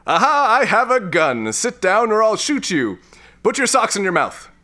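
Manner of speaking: high, excited commands; frantic threat. Acted. Man threatening intruder with gun, frantic. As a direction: threaten wildly, barely in control.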